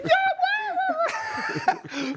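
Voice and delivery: very high laughing yelp; laughing bark. Retold or imitated. Students bark at the teacher, laughing high yelps.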